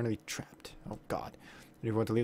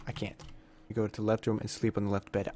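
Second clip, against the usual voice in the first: ordinary talk, nothing marked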